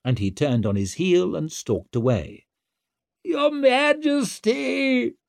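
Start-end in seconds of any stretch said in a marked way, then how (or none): 3.2-5.1 very high, drawn-out wail; long, desperate high-pitched cry. A narrator's reading. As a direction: wail after someone who is leaving. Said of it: "wailed Wetterland".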